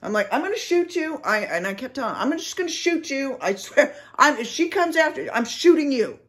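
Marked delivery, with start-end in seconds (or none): 0.3-1.2 high-pitched exasperated warning; retold threat. Retells threat to reckless companion. 2.2-6.2 high, urgent warning; exasperated retold threat. Retells repeated threat to companion.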